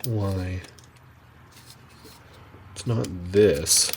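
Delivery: ordinary talk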